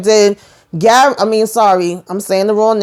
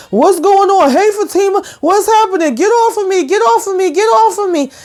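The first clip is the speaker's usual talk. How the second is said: high, squealing imitation of a man under attack; mock panic; 11 semitones higher than usual. He yells as the guys whip him, mock panic. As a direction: plead in a hammed-up high voice. Retold man being jumped, yelling.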